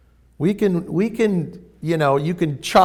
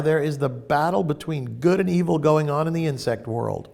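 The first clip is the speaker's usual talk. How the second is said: ordinary delivery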